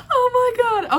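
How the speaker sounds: loud high stunned cry; real disbelief, high and loud; shocked exclamation. Real, not acted. Stunned reaction after laughing.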